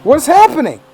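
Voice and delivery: a sudden alarmed squeal; startled yelp. Real, not acted. Swarmed by bees in the game, a high, loud startled yelp.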